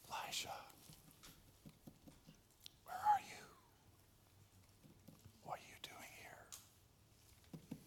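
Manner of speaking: soft, hushed, fading into a slow whisper; gently. Shown as a demonstration. God gently asking hiding Elijah where he is, soft whisper. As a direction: coax him out as softly as you can. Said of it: "How do I say it gently?".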